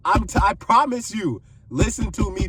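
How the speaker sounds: normal talking voice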